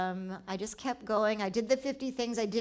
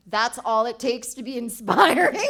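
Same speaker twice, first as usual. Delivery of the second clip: louder, words riding on a laugh; 10 dB louder than usual. Real, not acted. Laughing at how little it takes to inspire.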